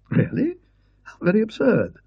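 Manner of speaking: high, incredulous scholar; a fussy don, surprised and dismissive; Parkins. Acted. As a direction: scholar scoffing at the maid's report. Parkins voiced by the narrator.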